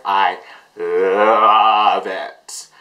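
"love" stretched out very long; slow, heavily leaned-on "love"; emphatic gushing. Real, not acted. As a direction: draw out "love" for several beats. Emphatic declaration of love for song.

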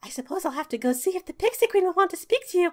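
breathy high fairy whisper; whispered pixie voice. Acted.

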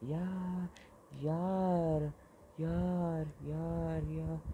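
high, soft drawn-out yaar; soft high moaning yaar; despairing yaar. Real, not acted. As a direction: moan it in defeat.

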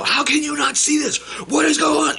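high, loud exasperated yell; enraged shout. Retold or imitated. Yelling at his brother who doesn't care. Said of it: "I, you know".

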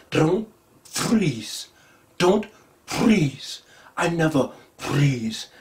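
lightly imitating the Wakandan accent; a hint of a Wakandan accent, slow on the last line. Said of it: "They pronounce that in such a strange way".

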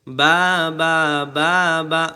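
drawn-out "বা", voice sent straight ahead; slow, firm "বা" drill on an open aa; forward zabar sound. Shown as a demonstration. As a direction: hold each "বা" and push it forward. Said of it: "আওয়াজ সোজা সামনের দিকে চলে যাবে".

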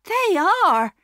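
high, delighted wife's voice; cheerful high-voiced reply; happy agreement. A narrator's reading. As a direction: agree gladly, pitched well up. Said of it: "agreed his wife happily".

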